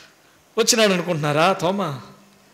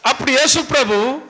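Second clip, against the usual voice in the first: ordinary talk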